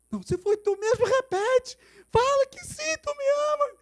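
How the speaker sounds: very high, loud, slowed demand; desperate shouted plea. Retold or imitated. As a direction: scream to heaven for a sign. Man demands repeat.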